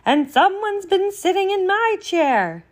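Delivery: high-pitched indignant mother bear; medium-sized Mama Bear. Acted. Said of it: "in her medium-sized voice".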